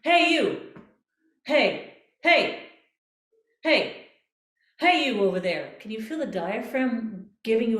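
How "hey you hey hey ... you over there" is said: short calls of hey, louder and higher; little called hey. Shown as a demonstration. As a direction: call out hey to someone across the room. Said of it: "Do a little hey".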